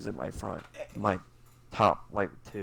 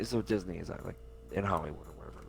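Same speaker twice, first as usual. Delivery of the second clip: ordinary talk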